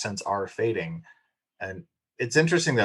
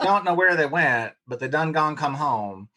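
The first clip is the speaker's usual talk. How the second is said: imitated country Oklahoma drawl, pitched up a little; 5 semitones higher than usual. Mom reporting on the cows by voicemail, imitated Oklahoma drawl. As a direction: play up the rural Oklahoma twang. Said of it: "I don't have that accent".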